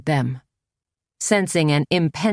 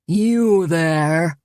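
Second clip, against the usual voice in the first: louder strident call across the yard; strident shout; 3 dB louder than usual. A narrator's reading. A cult leader yells at a trespasser, strident. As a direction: bark it at the stranger. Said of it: "he shrieked in a strident voice".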